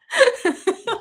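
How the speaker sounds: a high, loud burst of laughter; real delighted laugh. Real, not acted. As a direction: crack up. Laughing after dad's line.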